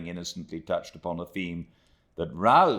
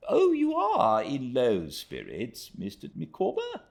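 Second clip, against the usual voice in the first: Traddles voice; 10 semitones higher, 3 dB louder than usual. A narrator's reading.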